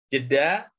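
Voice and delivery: normal talking voice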